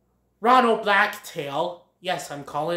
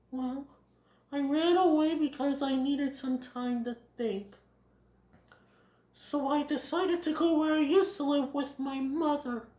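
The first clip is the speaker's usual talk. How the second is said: higher, gentle character voice; young Bambi explaining; 9 semitones higher, 4 dB quieter than usual. Acted. Bambi explains running away, gentle high voice. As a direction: explain softly in a raised pitch.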